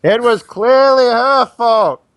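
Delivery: high, loud, drawn-out fake villager; planted accuser. Player switches sides of the crowd, loud fake accusation. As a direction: call out blame in a put-on voice. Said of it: "run back to the other side".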